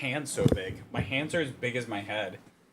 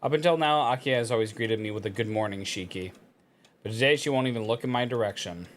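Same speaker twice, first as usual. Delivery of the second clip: story narration. Acted. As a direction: read it like a novel narrator. Reads visual novel text aloud.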